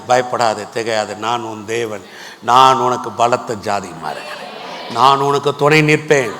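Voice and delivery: hushed, low-pitched divine comfort; calm reassurance. Acted. Voices God reassuring the fearful.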